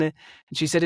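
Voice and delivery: usual speaking voice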